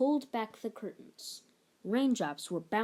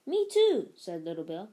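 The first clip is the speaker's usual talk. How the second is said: rallying cry; 8 dB louder than usual. Pretend. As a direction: rouse your friend to the quest. Child rallies to save kingdom.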